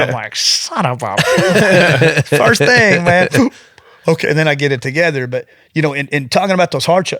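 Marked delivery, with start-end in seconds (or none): 0.4-2.2 slow, drawn-out, louder, higher groan of dismay; hammed-up horror at seeing himself; a stretched, half-laughing curse cut off; mock dismay. 2.3-3.5 real laugh through the words, higher and louder; laughing at his own story; words broken up by laughter. Real, not acted. Laughing at himself.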